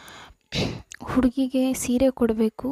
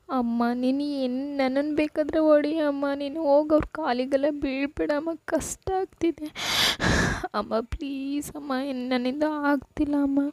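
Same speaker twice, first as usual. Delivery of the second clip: tearful pleading; 4 semitones higher than usual. Acted. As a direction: beg your mother through tears. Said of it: "ಹಂಗೆ ಅಳ್ತಾ ಇದ್ದೀರಾ?".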